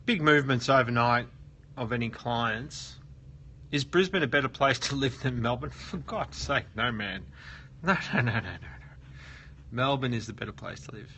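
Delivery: ordinary talk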